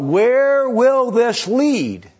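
high, slow, drawn-out wondering; pondering aloud. Retold or imitated. Preacher voices God pondering where this will lead, slow and high. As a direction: stretch it out as if gazing ahead. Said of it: "God is always thinking about".

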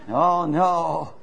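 normal talking voice

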